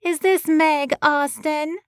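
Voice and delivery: high, slow nasal Boston voice; thick Boston accent, pinched through the nose; a Boston producer on the phone, nasal and thick-accented. A narrator's reading. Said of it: "A nasally voice came through the line in a thick Boston accent".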